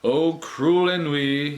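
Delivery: ordinary delivery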